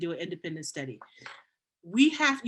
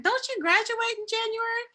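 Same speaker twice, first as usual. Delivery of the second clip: high-pitched imitation of a puzzled secretary; retold double-take, pitched well up; surprised query; 14 semitones higher than usual. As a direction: question it high, in mock surprise.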